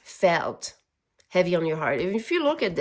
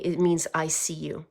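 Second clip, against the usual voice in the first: ordinary talk, nothing marked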